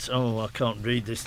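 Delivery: ordinary talk, nothing marked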